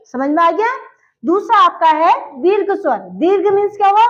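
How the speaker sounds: normal talking voice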